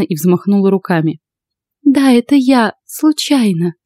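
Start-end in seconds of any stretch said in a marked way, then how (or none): 1.8-2.7 put-on high-pitched young woman's admission; blonde owning up. A narrator's reading. Blonde racer confessing to the husband, pleading. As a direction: admit it in a lifted voice.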